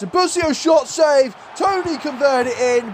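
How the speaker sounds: high-pitched, excited shot commentary; urgent match call. Real, not acted. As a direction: shout the chance as it happens. Calls a shot and rebound live.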